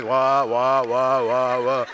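mock baby crying wah wah; muffled wah wah talk. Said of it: "I'm feeling like".

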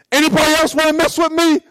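high-pitched, defiant dare; acted challenge. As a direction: play God daring all comers. Acting God challenging everyone.

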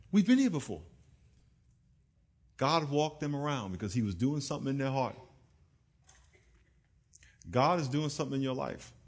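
ordinary delivery

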